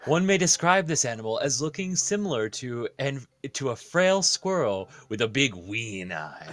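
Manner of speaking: ordinary talk, nothing marked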